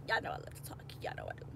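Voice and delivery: unvoiced whisper, quick and close; whispered playful aside. Real, not acted. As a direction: whisper it like a secret to the camera. Whispered aside about loving talk.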